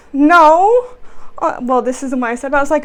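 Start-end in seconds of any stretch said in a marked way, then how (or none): 0.1-1.0 loud, higher, drawn-out no; exasperated retort. Acted. Worker answers a shouting customer, loud exasperated retort. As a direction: refuse it loudly and at length.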